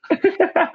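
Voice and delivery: sudden high-pitched laugh; a quick squeaky laugh at yourself; high burst of laughter. Real, not acted. Laughs at own story.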